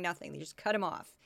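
ordinary delivery

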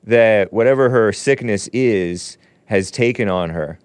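ordinary talk, nothing marked

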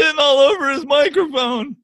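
high, loud mocking voice; whiny, shouted mock rant; Matty Goldberg. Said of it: "Somewhere Matty Goldberg's like".

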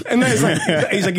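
ordinary talk, nothing marked

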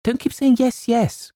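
very high-pitched exasperation; frantic, high-pitched protest; exasperated cry. A narrator's reading. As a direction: burst out at the end of your patience. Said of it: "cried James, clutching at his hair".

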